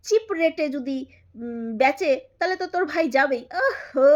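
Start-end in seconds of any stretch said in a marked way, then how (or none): 3.6-4.1 loud, shouted mock surprise; mock shock. Real, not acted. Vlogger yelling in mock shock at her rival's news. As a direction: cry out as if scandalised.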